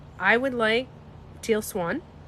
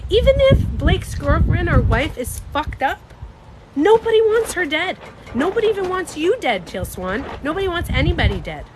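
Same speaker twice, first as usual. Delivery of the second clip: louder and higher, outraged; heated outburst; 7 semitones higher, 5 dB louder than usual. Real, not acted. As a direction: push back hard, voice climbing. Outraged rebuttal to viewers.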